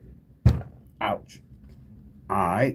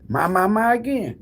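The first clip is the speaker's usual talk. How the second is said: a high, louder happy exclamation; surprised delight; 12 semitones higher, 4 dB louder than usual. Real, not acted. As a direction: react with amused surprise at the same winner. Same winner comments again.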